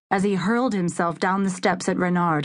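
ordinary delivery

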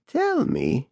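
a hint of old woman, high; lightly voiced crone; creaky old voice. A narrator's reading. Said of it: "Bat Lady said again".

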